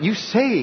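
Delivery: "You say" pitched high, rising on "say"; pointed stress. Retold or imitated. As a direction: lift "say" sharply and leave it hanging. Stresses the hearers' false claim.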